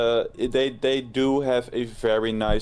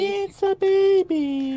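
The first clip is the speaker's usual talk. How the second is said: put-on squeaky high baby voice; baby talk; 5 dB louder, slower than usual.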